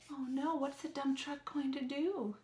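ordinary delivery

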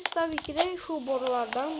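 usual speaking voice

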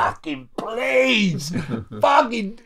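high, slow, crying plea; terrified pleading. Retold or imitated. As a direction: beg in high panic. Mock prayer in terror.

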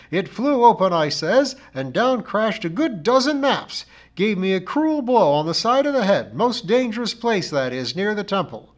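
high, loud account of a calamity; dramatic char lady. A narrator's reading. Missus Pringle flings her arms apart recounting the accident, dramatic. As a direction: make a great drama of the accident.

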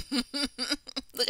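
plain reading voice

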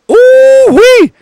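very high, loud squealing whoop; high-pitched mock cheer; excited whoop. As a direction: squeal with glee. Cheering a character on.

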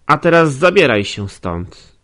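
loud, high brush-off; dismissive outburst. Acted. The feverish young man sending Nastazja away, loud. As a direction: wave her away with a sharp command.

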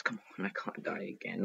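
ordinary talk, nothing marked

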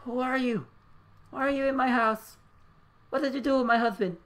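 confused mother accusing her son of being an intruder, frantic; frantic angry mother. Acted. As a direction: demand answers from a stranger in your house. Mother fails to recognise son.

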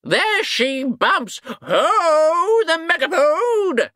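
hunting cry. A narrator's reading. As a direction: whoop the chase like a huntsman. Wizards chasing the beast.

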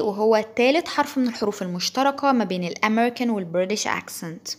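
ordinary talk

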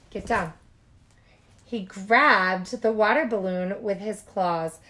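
usual speaking voice

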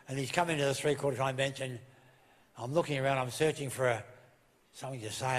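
ordinary delivery

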